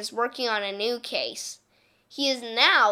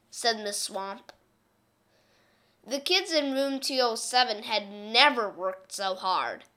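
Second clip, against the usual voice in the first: plain reading voice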